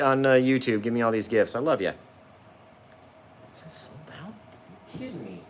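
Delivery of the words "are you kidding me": usual speaking voice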